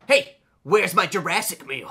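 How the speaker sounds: put-on teen demanding his meal from his tired brother, insistent. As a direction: lean on it like a spoiled kid.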